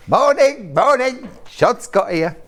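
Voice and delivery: hammed-up high Cockney greeting; bad Cockney accent. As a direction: call it out in a daft mock Cockney. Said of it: "Cockney accent".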